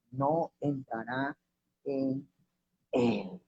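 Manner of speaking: slow and hushed, pausing before "en" and before "él"; weighty stress on él. Real, not acted. Preacher rereads the verse about the kingdom, quietly isolating "él" with pauses. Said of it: "la palabra él acentuada".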